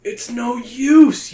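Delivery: high, loud, slow despairing cry. Acted. You crying out to your friends. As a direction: give up in alarm. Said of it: "you cry".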